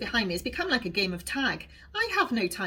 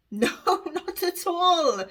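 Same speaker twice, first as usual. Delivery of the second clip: giggling; 9 semitones higher, slower than usual. A narrator's reading. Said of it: "she giggles".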